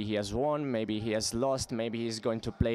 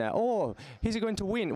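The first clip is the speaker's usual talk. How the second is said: Roman dialect; 8 semitones higher than usual. Retold or imitated. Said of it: "with this Roman dialect".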